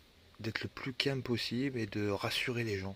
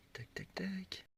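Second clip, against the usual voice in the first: whisper; 8 semitones higher, 9 dB quieter, much slower than usual. Real, not acted. Whispered filler while scrolling.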